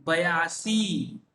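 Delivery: बयासी drawn out slowly, each syllable clear; teacher modelling बयासी slowly; slow pronunciation demo. Shown as a demonstration. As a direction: spell out बयासी slowly for the class. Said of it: "इसे किस तरह उच्चारण किया जाता है?".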